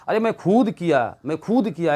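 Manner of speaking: खुद stressed in a bragging voice; boastful emphatic demo. Shown as a demonstration. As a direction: insist you did it yourself. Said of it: "जोर दे करके बोला जा रहा है".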